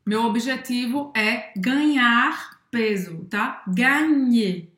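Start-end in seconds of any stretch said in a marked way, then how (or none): 3.7-4.7 Gagner with an open a in the first syllable; Gagner, gn sounding like Portuguese nh. Shown as a demonstration. Teacher models the open ga in Gagner. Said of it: "esse ga, ele é aberto".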